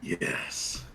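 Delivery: low, slow, unvoiced menacing whisper; menacing Jack. Jack looming behind the halfling, low menacing whisper. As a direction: breathe it coldly right behind him.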